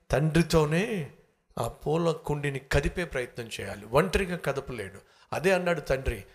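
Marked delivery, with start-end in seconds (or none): none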